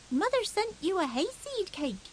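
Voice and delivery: put-on high, eager small boy's boast; a small hedgehog bragging to his cousins; little hedgehog voice. Little hedgehog boasting to his cousins, high and eager. Fuzzy Peg to the hen.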